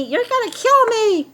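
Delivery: high-pitched mock hysterical complaint; mock yelling. Critics yelling at the host about masks, mock high yell. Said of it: "all the time yelling at me".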